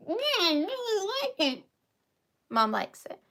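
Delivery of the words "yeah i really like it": lightly higher mom voice; voicing mom. Daughter voices her mannequin mom's approval, lightly. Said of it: "What do you think, Mom?".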